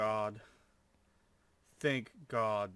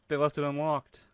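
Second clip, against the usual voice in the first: higher, louder burst of thrilled surprise; excited disbelief; 4 semitones higher, 11 dB louder than usual. Real, not acted. Streamer finding raid base left open, excited loud disbelief. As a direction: blurt it out, can't believe the luck. Finds enemy base unlocked.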